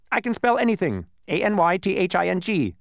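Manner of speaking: high, loud boastful bee; boasting bee. A narrator's reading. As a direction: brag like a showoff bee. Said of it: "he boasted".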